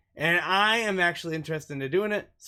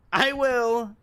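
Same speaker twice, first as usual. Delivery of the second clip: higher, louder quick promise; light reply; 8 semitones higher, 4 dB louder than usual. Acted. Allison reassures Rose before leaving, higher bright reply. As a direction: chirp it back to reassure her.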